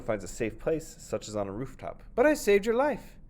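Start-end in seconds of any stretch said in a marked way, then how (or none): 2.2-3.2 high, loud pleading protest; indignant protest. Acted. The farmer protesting to the snake, high and loud. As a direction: protest the unfairness.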